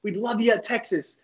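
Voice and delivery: high put-on coach voice; Coach Garrido. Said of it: "He's like".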